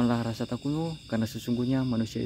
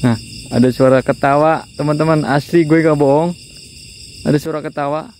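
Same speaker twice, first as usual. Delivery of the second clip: frightened loud; 3 semitones higher, 12 dB louder than usual. Real, not acted. Hears a woman laughing at night.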